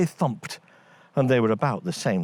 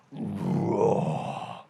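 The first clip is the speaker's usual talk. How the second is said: a put-on creature roar of rage; angry giant roar; 3 dB quieter than usual. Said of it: "roaring with anger".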